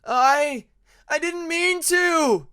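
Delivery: loud, high, slow pleading cry; high desperate wail to a corpse; desperate plea. Acted. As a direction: beg the body to forgive him. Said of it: "he pleaded desperately to the body".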